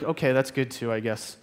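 quiet, half-hearted mock approval; grudging acceptance. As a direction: let it sink and trail off, underwhelmed. Voices the grudgingly satisfied people.

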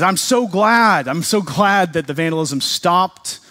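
high, loud, gushing relief; acted thrilled. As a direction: play a thrilled shop owner, stretching the gladness. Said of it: "He's just thrilled".

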